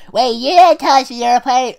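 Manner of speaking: high loud excited pony voice; high-pitched, high-energy Pinkie. Acted. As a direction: squeak it out full of energy. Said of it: "high-pitched, high-energy voice that she talks in".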